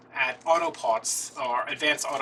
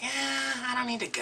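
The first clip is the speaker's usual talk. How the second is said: high put-on voice; 10 semitones higher than usual. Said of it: "I was like".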